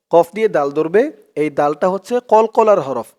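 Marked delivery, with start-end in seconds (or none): none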